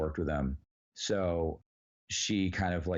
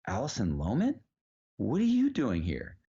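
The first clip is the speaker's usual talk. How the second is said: surprised recognition; 4 semitones higher than usual. Retold or imitated. Nick recognizes surprise visitor.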